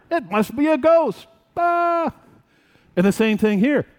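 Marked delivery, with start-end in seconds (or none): 0.1-2.1 high, scared gasp at a ghost; startled high-pitched ghost panic; frightened cry. Pretend. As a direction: cry out like you've seen a ghost. Disciples imagined seeing a ghost.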